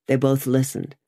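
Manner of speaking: plain reading voice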